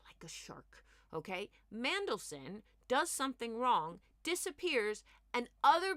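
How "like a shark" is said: conspiratorial whisper; hushed, secretive aside. Real, not acted. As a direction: lean in and whisper it. Confiding comparison of Johnson.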